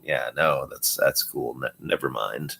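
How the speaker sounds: low, quiet mock disappointment; awkward letdown. Man put off by cool girl's voice, awkward low letdown. Acted letdown on hearing her voice.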